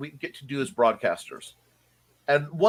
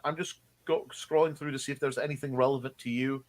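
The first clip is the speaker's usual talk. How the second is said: ordinary talk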